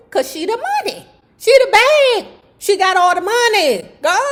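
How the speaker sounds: high, loud hyped exclamation; excited gush. Real, not acted. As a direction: hype her up as the money. Host gushing about money.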